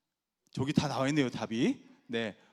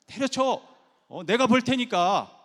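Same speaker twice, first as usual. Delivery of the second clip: loud, higher mock bluster; brash husband; 7 semitones higher, 7 dB louder, slower than usual. Husband answering wife's work complaint, loud brash bluster. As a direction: blurt out a big empty offer.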